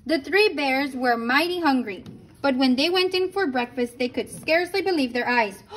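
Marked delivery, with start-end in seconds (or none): none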